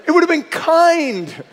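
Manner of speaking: high, loud, laughing protest; amused plea for mercy; laughing complaint. Retold or imitated. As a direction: laugh through a mock grievance. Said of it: "I'm like".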